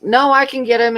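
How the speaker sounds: retold tug-of-war no, insisting; insistent refusal. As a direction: push back on his help, firmer now. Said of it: "I'm like".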